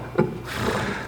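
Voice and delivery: a quiet unvoiced laugh to himself; soft breathy chuckle. Narrator chuckling to himself over the tub, soft laugh. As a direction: chuckle at your own cleverness. Laughs at his own cunning.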